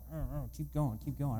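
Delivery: soft, under-the-breath aside; quietly mimed long-suffering parent; muted parental exasperation. Acted. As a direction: lightly act a parent enduring dinner-table complaints. Weary parent at dinner table.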